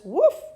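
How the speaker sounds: explosive high pop; loud whoosh. A narrator's reading. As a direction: burst it out. Sound of boy shooting up.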